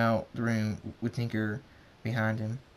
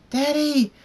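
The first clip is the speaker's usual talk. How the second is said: put-on very high child's scream; high-pitched cry for help; 9 dB louder than usual. A narrator's reading. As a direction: shriek for Daddy at full volume. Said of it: "Rain screams".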